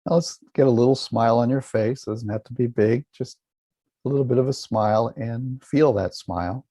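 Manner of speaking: calm, measured meditation voice with long pauses; guided meditation. Acted. Teacher guiding listeners to relax and feel a smile, calm meditation voice. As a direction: guide listeners gently inward.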